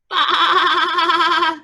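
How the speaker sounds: loud, high wobbling baa; long quavering baa; sheep bleat. A narrator's reading. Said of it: "Sheep said".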